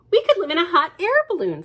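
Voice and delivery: mother blurting out an eager idea; eager, quick. Acted. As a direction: rattle it off excitedly. Mother's dreamy suggestion, quick.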